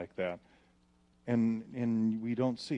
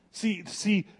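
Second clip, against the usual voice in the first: louder, higher, driving sermon; full-force preaching; 7 semitones higher, 8 dB louder than usual. Real, not acted. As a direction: preach it hard to the crowd.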